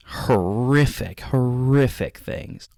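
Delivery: slow stretched "horrific", leaning on it; drawn-out horrific. Real, not acted. Describing the videos to viewers, slow dramatic emphasis. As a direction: drag out "horrific" for effect. Dwelling on the horror.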